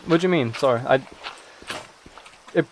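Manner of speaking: usual speaking voice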